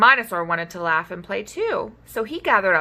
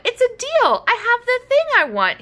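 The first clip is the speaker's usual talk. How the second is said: high, loud delighted squeal; a happy dino squealing to new friends; squealing joy; 5 dB louder than usual. Acted. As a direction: squeal it with relief. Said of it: "he squealed".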